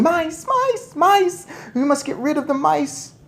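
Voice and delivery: very high, loud frantic yell. Acted. Diners yelling at the mice, frantic. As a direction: scream the alarm at the mice. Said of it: "everyone was yelling".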